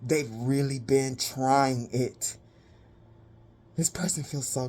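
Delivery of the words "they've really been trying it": ordinary talk, nothing marked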